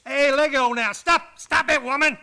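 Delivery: loud, flustered protest; man pushing off his kissing wife, loud and flustered; laughing protest. Acted. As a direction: fend her off, loud and flustered. Wife smothering him with kisses.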